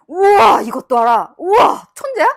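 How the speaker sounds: gushing praise. Shown as a demonstration. Teacher showing how young kids are praised: loud, high gushing. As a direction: gush like the kid is a genius.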